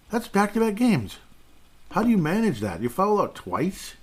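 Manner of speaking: high incredulous outcry; disbelief. Real, not acted. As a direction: pitch up in disbelief. Disbelief at fouling out twice.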